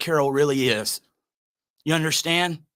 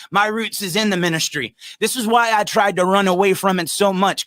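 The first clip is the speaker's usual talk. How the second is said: normal talking voice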